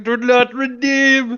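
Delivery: high, slow hammed-up Indian accent; imitating an Indian scammer's protest; mock Indian battle cry. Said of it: "a bunch of Indian O D S T".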